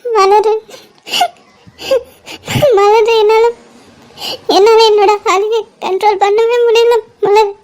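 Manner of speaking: halting, tearful confession between sobs; sobbing. Acted. Confiding heartbreak to friend Malar, sobbing uncontrollably. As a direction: unable to control the crying, words broken by gasps. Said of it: "ஏன் இப்படி தேம்பி தேம்பி அழுற".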